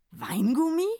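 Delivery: puzzled rising question; the druggist is baffled; confused. A narrator's reading. Druggist asking Santa, puzzled question. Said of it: "fragte verwirrt".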